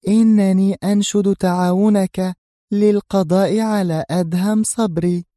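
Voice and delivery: high female voice, pressing each word slowly; slow, deliberate. A narrator's reading. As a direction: spell out the deadly request deliberately. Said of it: "وهي تقول في بطء".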